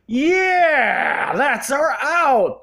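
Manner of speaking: a lightly voiced, gleeful studio exec; Sony exec. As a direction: relish the loophole, drawn out. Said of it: "And Sony also going".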